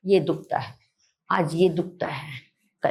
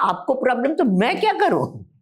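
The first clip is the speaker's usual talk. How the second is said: playful retort; 7 semitones higher than usual. Retold or imitated. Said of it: "मैंने कहा".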